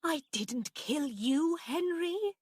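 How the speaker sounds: high, slow, anxious denial; frightened protest. A narrator's reading. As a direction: protest fearfully to a ghost. Aunt pleading with supposed ghost.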